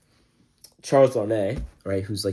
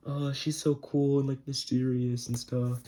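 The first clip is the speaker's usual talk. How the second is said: dreamy swooning voice; 5 semitones higher, 4 dB quieter than usual. Retold or imitated. Sydney swooning over Lucy.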